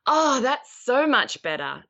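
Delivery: encouraging praise. Acted. Woman rewarding her partner's attempt, encouraging. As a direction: savour it and praise slowly. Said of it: "use good, better, best".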